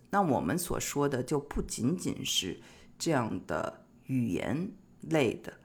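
ordinary talk

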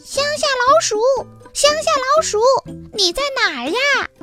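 calling out. A narrator's reading. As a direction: call for a friend far away. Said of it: "大声地喊着".

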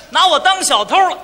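normal talking voice

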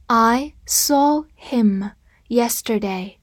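I saw him yesterday read slowly, word by word. Shown as a demonstration. Teacher reading slowly for the class to repeat. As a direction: read it slowly for students to follow. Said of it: "跟着我慢读一遍".

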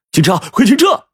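high, urgent yell; shouting. A narrator's reading. Son to the bus driver, frantic shouting. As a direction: cry out to stop the vehicle at once. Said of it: "冲着司机大喊".

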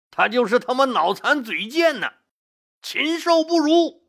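high-pitched outraged denunciation; indignant shouting. A narrator's reading. Angry ministers denouncing the enemy chief, indignant shouting. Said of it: "都纷纷高声喊道".

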